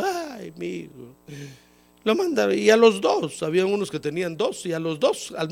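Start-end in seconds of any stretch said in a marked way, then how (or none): 0.0-1.1 mock crying wail. Mocking weeping parent wails about a son sent to war. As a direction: imitate a parent half-crying over a son. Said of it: "los latinos llorando".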